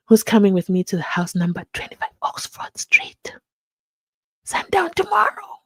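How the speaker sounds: conspiratorial whisper. Pretend. Inviting viewers to a raid, in a conspiratorial whisper. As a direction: hush it like plotting a raid.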